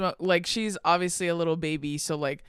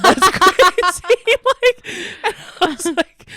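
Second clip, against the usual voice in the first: much higher and louder, slow, squeezed through laughter; laughing; 12 semitones higher, 11 dB louder, slower than usual. Real, not acted. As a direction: lose it laughing. Cracking up at Billy's line.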